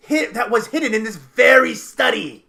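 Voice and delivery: high, loud, emphatic revelation; urgent outburst. Acted. Captive revealing where the book was hidden, urgent outburst.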